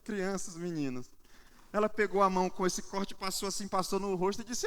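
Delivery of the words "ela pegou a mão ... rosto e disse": ordinary talk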